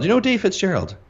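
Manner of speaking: high-pitched, put-on questioning voice; mock naive enthusiast; imitated eager inquirer. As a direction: ask high, sure they know him. Imitating someone asking after Dave.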